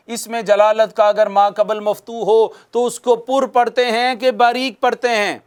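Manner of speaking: ordinary delivery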